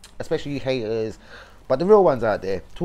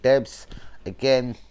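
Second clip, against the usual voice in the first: usual speaking voice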